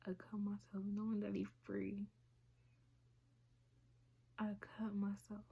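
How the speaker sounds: soft, unvoiced whisper; whispered confession. Real, not acted. Speaker admitting to viewers she cut herself, whispered. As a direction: say it under the breath, ashamed. Confessing self-harm, voice drops.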